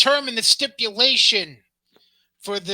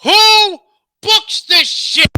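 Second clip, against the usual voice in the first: high, very loud, drawn-out yell; bellowed catchphrase in mock disbelief; mock-outraged shout; 12 semitones higher, 11 dB louder, much slower than usual. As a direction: holler it slowly, word by word.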